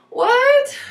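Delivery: very high, loud what; startled outburst. Real, not acted. Vlogger startled by a loud bang overhead, high what. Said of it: "reacting to noise".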